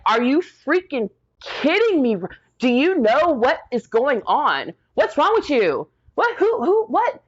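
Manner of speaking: high-pitched, incredulous outburst retelling a confrontation; outraged disbelief. Retold or imitated. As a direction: act out indignant disbelief, pitch leaping up. Arguing with nurse over visitor limit.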